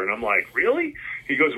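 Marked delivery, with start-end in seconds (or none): 0.5-0.9 high, rising disbelieving "Really?"; surprised. Retold or imitated. Mall shop owner's offer to a teen, answered in high surprise. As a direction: kid hearing an offer he can't believe, high and surprised. Kid offered to sell photos.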